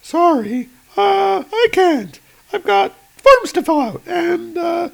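high, bright agent voice; high female voice. Acted. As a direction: raise the pitch for the woman agent. Agent makes an excuse.

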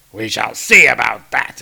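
lightly voiced menacing troll king; a hint of a gloating brute; troll king Ulik. Ulik replies.

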